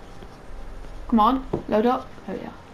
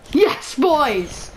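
excited cheer; 6 semitones higher, 4 dB louder than usual. Real, not acted. Celebrating a kill with teammates.

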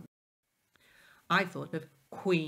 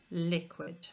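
ordinary talk, nothing marked

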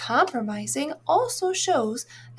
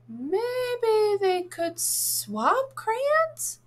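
higher, very slow, wondering character voice; Happiness Spot voice; 6 semitones higher, much slower than usual. Acted. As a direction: float the idea slowly as the spot. Spot character suggests a swap.

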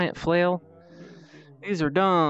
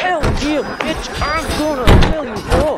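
loud, fast, high battle taunt; hyped-up threat; 8 semitones higher, 5 dB louder than usual. Real, not acted. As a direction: rush it out, pumped for the kill.

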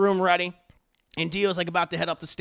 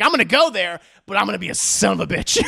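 louder hammed-up villain boast; scheming villain; 7 dB louder than usual. Dio plotting over his father's grave, loud gloat.

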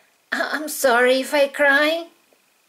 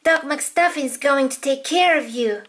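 usual speaking voice

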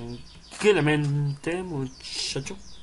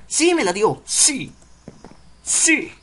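high, loud celebration; excited cheering; 6 semitones higher, 7 dB louder, slower than usual. Real, not acted. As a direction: cheer a lucky win, rising each time. Celebra lo que le dieron. (celebrando a gritos)

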